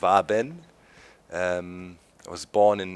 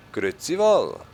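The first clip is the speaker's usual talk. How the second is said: higher sing-song Swiss greeting; Swiss German; 5 semitones higher than usual. Shown as a demonstration. Greeting viewers in Swiss German. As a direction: sing it lightly, Swiss style. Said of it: "it would go like this".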